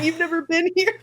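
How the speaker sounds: high-pitched incredulous question; hammed-up retold astonishment; mock disbelief. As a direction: ask it aghast, replaying your shock. Acted disbelief at friends.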